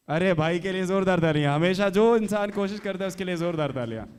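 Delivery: loud, high call for applause; hyping the crowd. Real, not acted. Host rousing the audience to cheer for Praveen, loud. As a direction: pump the audience up.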